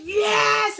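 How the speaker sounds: loud, high cheer; excited yes. Acted. The lion sees the carrots falling and cheers loudly. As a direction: shout it out in joy.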